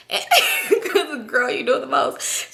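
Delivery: bursting out laughing; real laugh. Real, not acted. Laughing at the story.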